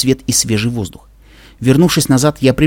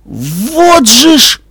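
high, slow, breath-pushed exclamation; stunned gasp; 11 semitones higher, 4 dB louder, much slower than usual. Acted. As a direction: gasp it out in shock. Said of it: "выдохнула Дэши".

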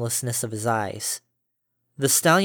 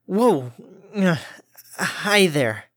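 startled; 5 semitones higher, much slower than usual. Acted. Harmony startled by glaring stallion.

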